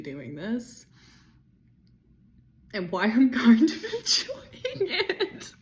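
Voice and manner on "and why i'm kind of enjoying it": guilty laugh through the words. Real, not acted. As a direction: laugh while admitting it. Laughing at own cruelty.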